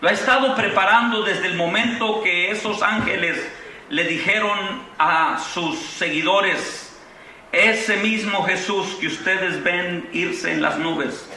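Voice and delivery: ordinary talk, nothing marked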